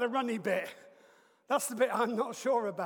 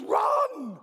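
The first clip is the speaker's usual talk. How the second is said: urgent yell of run; shouted command; 11 semitones higher, 9 dB louder than usual. Retold or imitated. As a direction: run yelled high and loud. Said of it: "all he kept shouting in my ear was".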